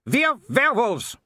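high, loud, barked retort; snapped. A narrator's reading. Baroness corrects the intruder, snapping high and loud. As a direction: bark the words sharply, pitched far up. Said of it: "snapped the Baroness".

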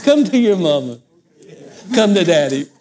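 sarcastic cooing; a hint of a sergeant faking tenderness to new recruits; mock sweet drill sergeant. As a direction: coo like a fake-sweet sergeant. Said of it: "the drill sergeant".

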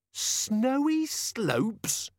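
high, slow excited shout. A narrator's reading. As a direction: call out the name, high and slow. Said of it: "shouted Carrot".

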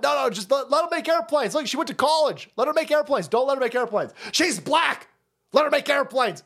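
high, eager mocking voice; sarcastic high voice pushing the hire; mock hiring manager.

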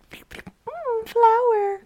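high, airy mock butterfly voice; a dreamy little bug savoring a flower; dopey butterfly. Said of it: "They're just like".

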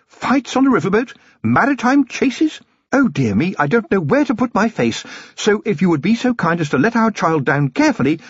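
a fond, well-bred wife; Lady Sybil, genteel lady. Acted. Wife cutting in on her husband, refined lady.

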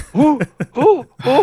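high, loud bewildered "Who?"; baffled shout. Retold or imitated. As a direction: shout it in sheer confusion. Baffled retort to opponent's card.

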